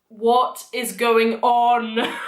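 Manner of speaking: slow, drawn-out disbelief; exaggerated bewilderment. Fan reacting to Voldemort Day, slow mock bewilderment. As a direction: stretch each word out, utterly baffled.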